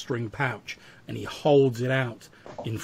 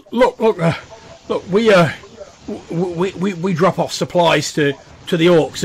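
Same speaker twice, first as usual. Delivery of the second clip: higher, louder flustered stutter; frightened man talking his way out; scared, worried stammering; 6 semitones higher, 9 dB louder, faster than usual. Acted. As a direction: stumble through a nervous explanation. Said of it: "even more scared and worried".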